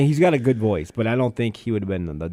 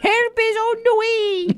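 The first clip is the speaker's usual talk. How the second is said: very high slow falsetto; Mrs. Doubtfire; slower than usual. Retold or imitated. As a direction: sing it out like an old nanny. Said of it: "Missus Doubtfire".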